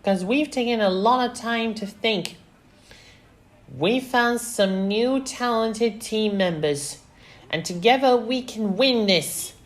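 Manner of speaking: put-on high, loud, determined girl; rally them in a high voice; Velma. A narrator's reading. Daughter convincing players they can win, high and determined. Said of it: "she said".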